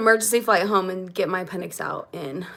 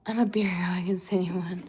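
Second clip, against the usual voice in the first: whispering; 5 semitones lower, 7 dB quieter than usual. Pretend. Playful aside to chat.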